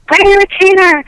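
high, loud scolding mom voice; nagging mom. Retold or imitated. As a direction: bark it like a strict mother. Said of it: "she's like".

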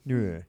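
"Noir" with an exaggerated French vowel; French pronunciation. Shown as a demonstration. Host playing with the guest's name, mock-French "Noir". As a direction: overdo the French sound in "Noir". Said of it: "As the French say".

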